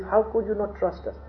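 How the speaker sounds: high-pitched imitated reproach; mock disbelief at being mistrusted; reproachful. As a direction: plead reproachfully with a father. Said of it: "in a very reproachful way to their father".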